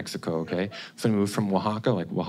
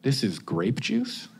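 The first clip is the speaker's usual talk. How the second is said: slow, softer questioning lift at the end; struggling alcoholic; 5 dB quieter, slower than usual. Acted. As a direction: hesitantly ask as a recovering alcoholic in church. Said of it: "impression of a recovering yet struggling alcoholic".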